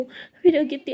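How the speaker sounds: plain reading voice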